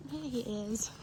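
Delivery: normal talking voice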